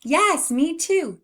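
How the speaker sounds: high, loud, drawn-out cheer; delighted agreement. Pretend. Teacher celebrates children's right answer, delighted. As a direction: celebrate slowly with a high lift.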